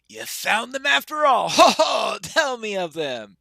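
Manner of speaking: delighted, booming shout with a hearty ho-ho; Gimli. Acted. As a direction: jubilant dwarf roaring for news. Gimli hears dwarves were found.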